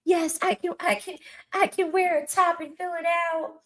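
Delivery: very high, put-on dainty voice; mock effeminate man. Mocking men who can fill out tops, high gushing voice.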